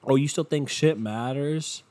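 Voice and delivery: ordinary talk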